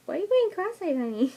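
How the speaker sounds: sudden high-pitched teasing quote, then back down; teasing question. Retold or imitated. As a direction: lightly mimic someone amused by her face. Quoting someone during pushing.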